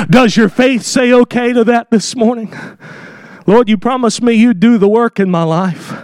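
ordinary talk

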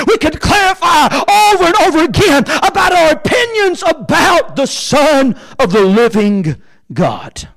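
high, mounting preacher's cadence; full-force preaching. Acted. Preacher sums up opinions about the Son, full-force preaching. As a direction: drive it hard, then stretch out the close.

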